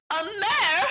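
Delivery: the insult sinks in fully; outraged shriek. Retold or imitated. Unicorn repeats the insult.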